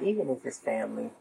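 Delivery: "even if it's family" set apart, with "even" lifted; emphasis on "even if it's family". Real, not acted. Warning listeners about relatives, "even if it's family" said with deliberate emphasis. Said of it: "I have to put emphasis on, on that".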